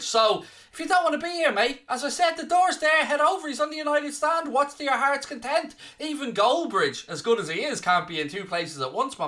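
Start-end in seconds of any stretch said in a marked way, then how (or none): none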